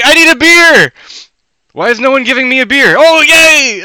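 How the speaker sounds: high, loud rowdy yell for a beer; drunk fan. Retold or imitated. Retelling how Jimmy demanded a beer, hammed-up yell. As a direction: holler like a tailgating fan begging for beer. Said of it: "And then he was like".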